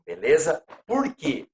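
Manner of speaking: ordinary talk, nothing marked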